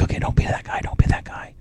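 whispered pep talk to himself; whispered self-talk. Retold or imitated. Panicking in the MRI tube, whispering to himself. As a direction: hush it to yourself so nobody hears. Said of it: "I'm like".

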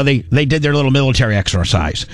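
usual speaking voice

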